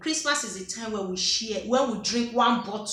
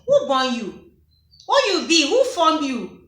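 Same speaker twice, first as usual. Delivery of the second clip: a hint of a scolding Nigerian parent, louder; mock scolding parent; 5 semitones higher, 6 dB louder than usual. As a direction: snap at the kids like an annoyed mum. Imitating parents scolding children.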